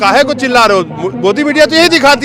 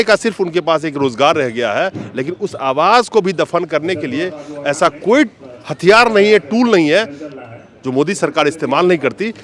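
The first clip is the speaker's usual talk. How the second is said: lower, measured reporter summing-up; news piece to camera; 6 semitones lower, 4 dB quieter than usual. Acted. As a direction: address the viewers in a subdued reporting voice.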